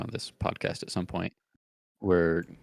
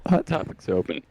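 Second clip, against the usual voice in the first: put-on drunk person voice; 10 semitones higher, slower than usual. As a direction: play a drunk shopper, much higher. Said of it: "sounded more like a very drunk person at the mall".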